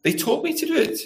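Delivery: put-on high, soft child making excuses; child's excuse. Preacher acting a youngster's excuse, high and soft. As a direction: plead it like a child passing the blame. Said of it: "you ever tried the excuse".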